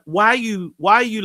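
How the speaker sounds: ordinary delivery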